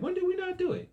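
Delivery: squeaky high mock protest; indignant goats. Preacher voicing the goats protesting to Jesus, squeaky and high. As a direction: play them shocked and defensive, voice shooting up. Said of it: "And they said".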